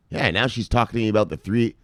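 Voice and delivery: usual speaking voice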